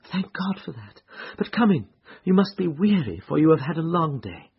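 high woman's voice, relieved; relieved wife. A narrator's reading. Wife welcomes the tired detective, relieved.

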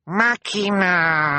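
high, long drawn-out call; insist, stretching the last vowel right out; insistent cry. A narrator's reading. Sunny pointing at the sky, insistent cry. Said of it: "Sunny insisted".

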